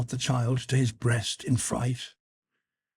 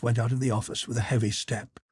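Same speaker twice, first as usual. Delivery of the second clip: ordinary delivery, nothing marked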